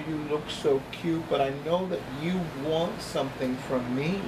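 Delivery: usual speaking voice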